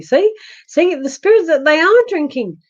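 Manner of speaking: the voice pops back out after the hiccup, high and bright; voice suddenly back. Real, not acted. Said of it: "Oh, my voice came back then too".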